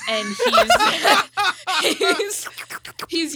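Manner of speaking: breaking into high laughter; laughing. Real, not acted. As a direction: laugh through the words.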